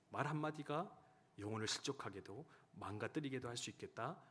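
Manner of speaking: ordinary talk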